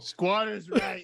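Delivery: amused, stretched-out quip; laughing joke. Real, not acted. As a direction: crack the joke while laughing. Joking along as table laughs.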